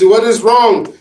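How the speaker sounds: put-on amateur Caribbean accent, gently probing; mock Caribbean accent, concerned question; bad Caribbean accent. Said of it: "obviously my accent's terrible".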